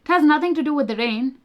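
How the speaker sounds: ordinary talk, nothing marked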